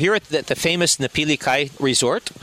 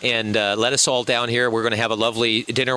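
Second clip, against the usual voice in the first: ordinary talk, nothing marked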